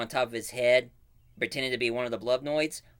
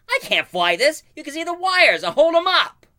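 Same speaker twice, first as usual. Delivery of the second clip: much higher and louder cartoon panic; frantic Buzz; 10 dB louder than usual. Buzz in the cheap rocket, high loud panic. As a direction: squeal it in a rising panic. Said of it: "Buzz says".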